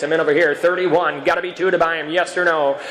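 usual speaking voice

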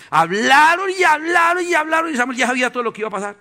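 loud, high, driving sermon build; fiery preaching. Real, not acted. Preacher stirring the congregation, loud and high. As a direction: preach it out with rising fire.

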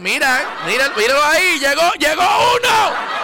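high, loud excited calling out; excited shout. Real, not acted. Host spotting a viewer comment, excited high shout. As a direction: shout it out pointing at the screen.

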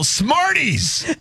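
high, mock-yelled Smarties; a hint of a yell. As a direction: blurt it high like a silly shout. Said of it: "I yell".

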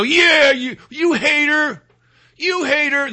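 high, drawn-out imitation of a dim follower; mock mob jeer. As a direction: jeer along like a mindless mob. Imitates a mob jeering on cue.

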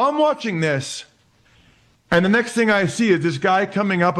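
ordinary delivery

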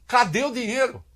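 high-pitched rhetorical question; pointed question. Real, not acted. Commentator asking where the money is, pointed.

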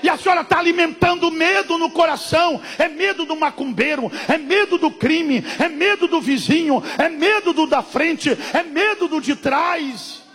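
loud, high shouted sermon; preacher listing the fears at full force; fiery preaching. Acted. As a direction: drive each "medo" home.

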